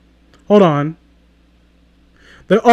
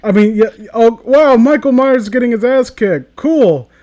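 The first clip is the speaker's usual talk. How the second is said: louder and higher, reacting live to the film; excited reaction; 6 semitones higher, 4 dB louder than usual. Real, not acted. Watching Michael Myers get beaten, excitedly. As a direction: get caught up in the fight on screen.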